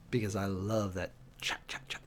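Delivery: a hushed fond aside to the viewers; whispered aside. Real, not acted. Thanking chat while the bot posts, whispered. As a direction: whisper it, trailing off.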